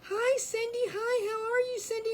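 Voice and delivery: high, chirpy mock greeting; imitating the lady. A lady greeting her by the wrong name, chirpy. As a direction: gush like a stranger who knows you. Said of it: "I had this lady call me".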